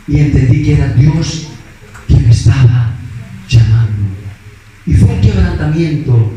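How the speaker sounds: low, weighty and deliberate; solemn, heartfelt testimony. Real, not acted. Preacher telling of being broken when God called, solemn and heartfelt. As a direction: drop low and let each phrase land heavily.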